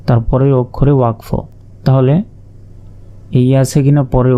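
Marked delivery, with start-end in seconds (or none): none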